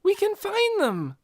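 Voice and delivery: put-on high, eager girl voice; eager cry. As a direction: urge her on hopefully. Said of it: "Nikki cried".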